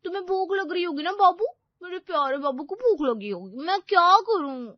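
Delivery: put-on high, fussing baby-talk over a partner; high-voiced clingy girlfriend; doting girlfriend.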